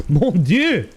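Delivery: high-pitched jolly Frenchman; jolly exclamation. Acted. Jolly man reacting to the officer's throw, exclaiming high. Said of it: "the jolly man exclaims".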